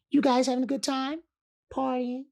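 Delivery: gentle high amused question. Retold or imitated. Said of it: "he said".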